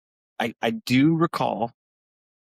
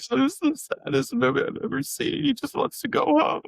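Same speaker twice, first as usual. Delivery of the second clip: very high, hammed-up tearful complaint; mock wailing. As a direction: wail like an upset teen, exaggerated. Wailing as upset teen at film.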